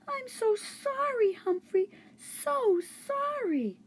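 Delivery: put-on high, slow, tearful girl's voice; girl hugging her hurt hamster, close to tears; sorry and tearful. A narrator's reading. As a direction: ache with pity for the hamster.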